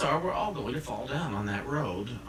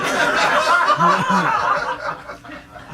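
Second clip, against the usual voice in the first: loud breathy cackle, much higher; big delighted laugh; 12 dB louder than usual. Real, not acted.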